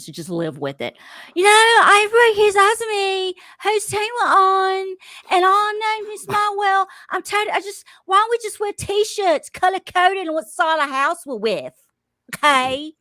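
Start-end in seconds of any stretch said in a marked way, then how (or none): 1.3-12.9 high, loud Australian accent imitation, half-laughing; hammed-up Australian accent mimicking a houseguest; Bowie's Australian accent. Retelling Bowie's complaint about teams to the podcast, Australian accent imitation. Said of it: "I can't do the accent".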